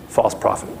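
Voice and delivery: dropped to a near whisper; low, breathy condemnation; hushed verdict. Real, not acted. Quiet verdict after list.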